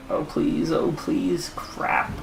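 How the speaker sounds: voiceless, defeated plea; whispered pleading. Real, not acted. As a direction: beg under your breath. Pleading after the bad result.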